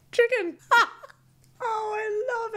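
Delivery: laughing squeal. Real, not acted. Reading a viewer's answer, high laughing squeal.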